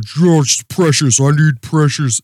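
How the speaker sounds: rough stab at a Texas drawl; attempted Texas accent. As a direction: try a Buffalo Bill Texas accent. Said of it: "I can't do a Texas accent".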